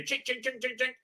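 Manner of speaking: high, quiet chisel tinks; imitated chipping noise. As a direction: mimic a chisel tapping stone.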